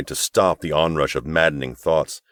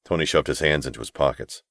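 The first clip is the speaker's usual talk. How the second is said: ordinary delivery, nothing marked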